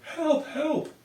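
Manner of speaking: high mock cry for help; yelling help. Girl in the ocean calls to her father, high mock yell. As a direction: call out like a kid in the water. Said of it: "Christy swam around yelling".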